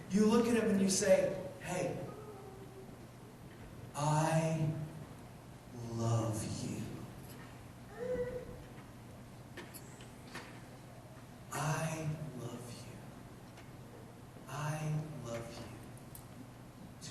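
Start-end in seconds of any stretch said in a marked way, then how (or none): 1.6-13.0 much lower and softer, slow; soft forgiving whisper of love; tender hush. Acted. Tender words to hostile friends. 14.5-17.1 unvoiced, breathy hush; barely-there whispered love.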